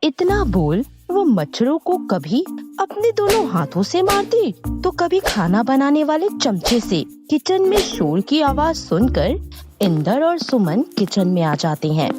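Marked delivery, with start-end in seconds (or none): none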